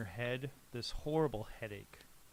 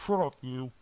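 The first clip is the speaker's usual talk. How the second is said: gruff captor; 8 dB louder than usual. Acted. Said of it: "a voice that is closer now that says".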